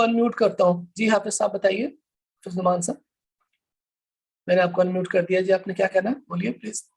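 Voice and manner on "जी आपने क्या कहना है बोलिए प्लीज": ordinary delivery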